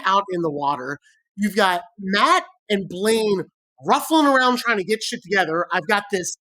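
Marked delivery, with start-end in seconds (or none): none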